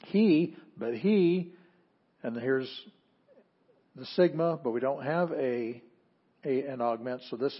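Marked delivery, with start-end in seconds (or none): 0.1-1.5 he pushed up high and drawn out; emphatic HE. Shown as a demonstration. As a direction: lift and lean on he. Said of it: "that is emphasis".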